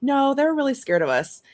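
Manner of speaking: put-on high, earnest little kid; child voice. Aunt imitating her niece's serious answer.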